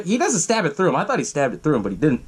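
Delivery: normal talking voice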